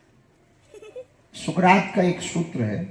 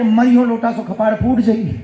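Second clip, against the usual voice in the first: high, loud, agitated repetition; ghost's alarmed retelling; 7 semitones higher, 8 dB louder than usual. Retold or imitated. Ghost repeating the threat it has just heard, mock alarm. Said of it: "भूत को लगा कि ये हमें पहचान गया".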